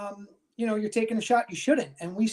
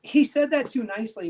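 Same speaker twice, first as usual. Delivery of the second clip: usual speaking voice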